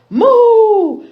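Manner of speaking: loud, very high moo; a big silly cow moo. A narrator's reading. The cow moos at the royal family, loud and silly.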